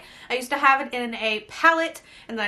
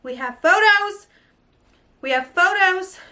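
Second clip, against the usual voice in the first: higher and louder, bursting with news; thrilled; 5 semitones higher, 4 dB louder than usual. Real, not acted. Vlogger tells viewers the box photos are up, thrilled. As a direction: shout the good news to your viewers.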